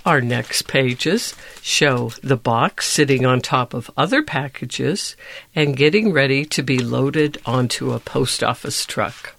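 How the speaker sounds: ordinary delivery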